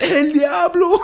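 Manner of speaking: very high, loud imitation; hammed-up shriek breaking into laughter; classmate's outburst. Contrasting classmate's reaction with ours, shrieked outburst. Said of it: "and he says".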